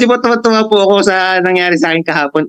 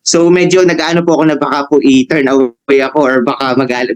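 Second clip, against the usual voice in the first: ordinary talk, nothing marked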